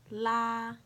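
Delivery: "La" held high and level; first tone. Shown as a demonstration. Teacher showing the four tones, "La" high and level. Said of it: "I'll say the four tones".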